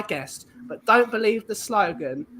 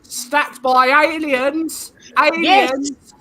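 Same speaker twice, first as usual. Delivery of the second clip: dramatic aliens line; 5 semitones higher, 7 dB louder, much slower than usual. Acted. Mock dramatic reading of chat.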